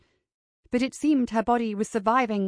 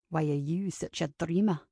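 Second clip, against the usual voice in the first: low, quiet man's retort; low male retort; 5 semitones lower, 4 dB quieter than usual. A narrator's reading. Kincaid mirrors Ava's question back, low quiet retort. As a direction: throw it back low and understated.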